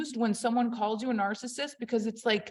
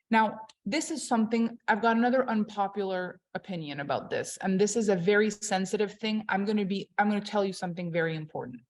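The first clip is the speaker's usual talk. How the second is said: usual speaking voice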